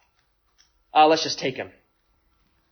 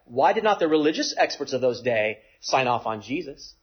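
ordinary talk

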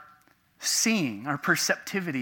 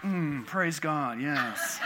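hide your glee behind solemn approval; mock pious restraint; much slower than usual. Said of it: "Externally, I was like".

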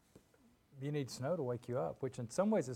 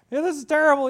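high, loud, panicky scarecrow; 14 semitones higher, 14 dB louder, faster than usual. Retold or imitated. Preacher acting out the scarecrow's panic in a high yelp. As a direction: squeal it like a frightened straw man.

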